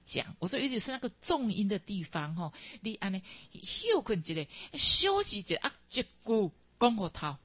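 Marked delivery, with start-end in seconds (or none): none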